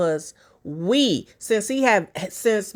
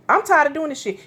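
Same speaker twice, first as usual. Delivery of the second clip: higher mock wife voice; 7 semitones higher, 3 dB louder, faster than usual. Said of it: "that's what she meant".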